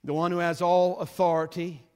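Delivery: loud, rolling pulpit delivery; full-force preaching. Acted. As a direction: proclaim it to the whole congregation. Preaching the opening verse loudly.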